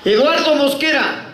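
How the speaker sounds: high-pitched call of a full name. Acted. Acted officer calling out his name.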